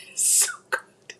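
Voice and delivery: slow whisper, savoring. Real, not acted. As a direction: breathe it out slowly, savoring. Tasting, whispered then exclaimed.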